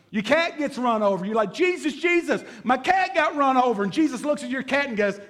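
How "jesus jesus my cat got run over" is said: high, hammed-up appeal for help; mock distressed plea. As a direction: beg in pretend panic over a pet. Same plea, now for a cat.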